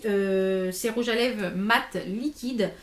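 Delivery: usual speaking voice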